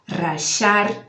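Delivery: rachar with the y as sh; the voiceless sh in rachar; sh variant. Shown as a demonstration. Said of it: "vai depender do país".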